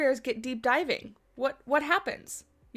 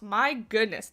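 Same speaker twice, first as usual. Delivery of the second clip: ordinary delivery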